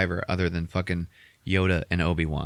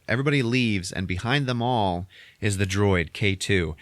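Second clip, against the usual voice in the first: usual speaking voice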